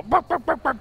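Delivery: high yappy dog barks; barking. Shown as a demonstration. Casters asked for barks, yapping like a small dog. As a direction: bark like a small dog on request. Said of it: "We'll just have to do them now".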